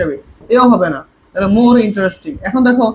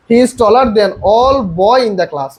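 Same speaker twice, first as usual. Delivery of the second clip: slow dictation; much slower than usual. Shown as a demonstration. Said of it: "আমরা সবগুলোকে পড়ি".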